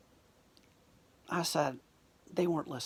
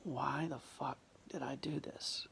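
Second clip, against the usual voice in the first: soft whispered self-reproach; inner thought whisper; 2 semitones lower, 9 dB quieter than usual. Retold or imitated. Man regretting his choice whispers the thought in his head. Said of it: "thinking in my head".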